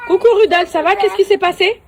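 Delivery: high, loud cheery hail; calling out. Real, not acted. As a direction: call across to someone at a distance. Calls out to Rudolf outside.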